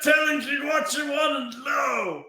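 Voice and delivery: desperate shout. Acted. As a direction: plead at full volume. Character yells at interrogators.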